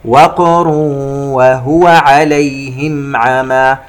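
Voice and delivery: slow chant, bounced q in "وقر", hummed into "وهو"; qalqala and ghunna. Shown as a demonstration. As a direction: bounce the qaf of "وقر", then hold the nasal. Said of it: "نقلقل القاف في كلمة وقر". (ترتيل بقلقلة قاف "وقر" وغنة)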